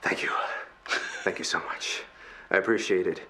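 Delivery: low, very soft voice; weak rescued castaway. Acted. Rescued undead man thanks the crew, faint low voice. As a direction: give faint, subdued thanks. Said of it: "It's like".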